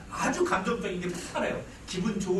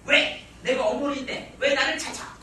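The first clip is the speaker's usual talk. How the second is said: indignant grandma; 7 semitones higher, 5 dB louder, slower than usual. Retold or imitated. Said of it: "할머니가".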